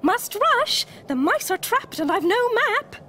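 high, hurried Mole voice; flustered little storybook animal; urgent gasp. A narrator's reading. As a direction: gasp out the emergency in a high pitch. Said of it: "gasped Mole".

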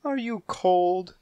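high, slow tender question; tender cooing. Acted. Mother cooing to kitten.

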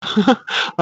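short, soft chuckle; amused laugh. Acted. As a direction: laugh lightly at your lovesick sister. Brother amused by sister's lovesickness.

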